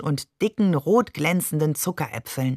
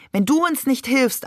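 urgent plea; 6 semitones higher than usual. A narrator's reading. Uncles begging for help by phone.

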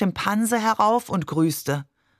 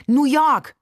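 put-on high, louder child's proposal; eager suggestion; 8 semitones higher, 5 dB louder than usual. A narrator's reading. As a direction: pitch "New York" up hopefully, as a child. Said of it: "schlug ich vor". (lebhafter, hoher Vorschlag)

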